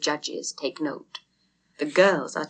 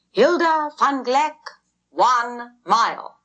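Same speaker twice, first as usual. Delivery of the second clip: high, loud, slow announcement; crier's call; 7 semitones higher, 4 dB louder, slower than usual. A narrator's reading. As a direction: ring it out to the whole crowd. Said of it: "The crier's voice is heard once again".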